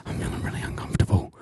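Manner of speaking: hushed nervous whisper. Retold or imitated. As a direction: whisper it uneasily. Whispered inner thoughts in cinema.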